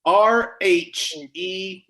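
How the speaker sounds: letters spelled out one by one, slowly; slow spelling. Shown as a demonstration. Host spelling the answer for players, slow and deliberate. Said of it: "spell rheumatoid".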